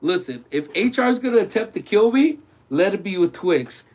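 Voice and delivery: normal talking voice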